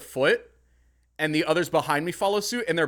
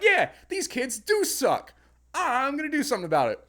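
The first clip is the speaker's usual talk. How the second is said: mock righteous; 10 semitones higher, 3 dB louder than usual. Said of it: "And I'm like".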